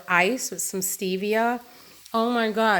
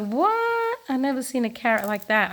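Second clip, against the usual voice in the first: retold surprise; 4 semitones higher than usual. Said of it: "I was like".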